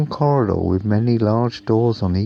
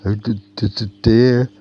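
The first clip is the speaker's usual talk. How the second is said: frightened stammer; 2 semitones higher, 4 dB louder than usual. Acted. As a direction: stutter the d sounds in fear. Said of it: "stammered Bobby Bus".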